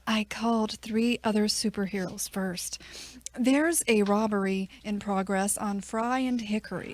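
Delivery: soft unvoiced whisper; whispered aside. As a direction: whisper it under your breath. Chief mutters aside.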